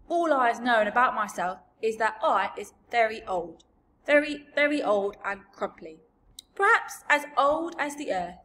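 put-on loud, musing old giant voice; big crumply giant, rising in pitch at the end. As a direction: boast loudly of great age. BFG describes his great age.

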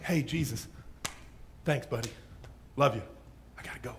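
casual brush-off. Acted. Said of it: "It's not just like".

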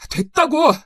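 high, loud burst of frustration; angry refusal. Acted. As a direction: shut her down with a shout. Son ends the quarrel angrily. (버럭 화내며 내뱉는 말투)

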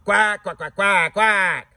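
high, loud duck imitation; parroting the ducks; mock quacking. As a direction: quack at them.

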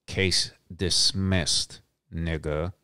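each word drawn out, savoring the win; slow victorious declaration; slow triumphant. Real, not acted. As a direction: let it land slow like a verdict. Triumphant punchline of court story.

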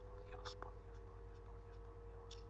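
rapid whispered plea under the breath; frightened whisper. Real, not acted. Gamer facing a creepy scene, whispering a frightened plea. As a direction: pray it under your breath, terrified.

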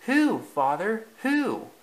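high, eager questioning; inquisitive. A narrator's reading. Bambi questions his father, high and inquisitive. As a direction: press for the answer, pitch leaping up. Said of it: "asked Bambi inquisitively".

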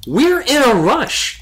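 high, loud, drawn-out protest; anger and fear. A narrator's reading. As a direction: insist urgently, frightened and cross. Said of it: "in a mixture of anger and fear".

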